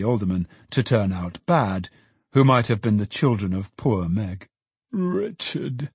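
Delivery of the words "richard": high, soft moan; moaning softly for someone lost; plaintive moan. A narrator's reading. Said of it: "moaned Trotty".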